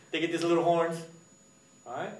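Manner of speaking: ordinary talk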